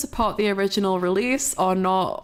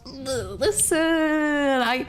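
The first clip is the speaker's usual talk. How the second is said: playful pleading; 7 semitones higher, slower than usual. Real, not acted.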